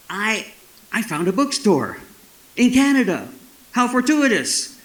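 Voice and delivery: high-pitched, theatrical wonder; hammed-up awe, halting and high; delighted discovery. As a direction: marvel at a lucky find, pausing between phrases. Thrilled discovery of a bookstore abroad.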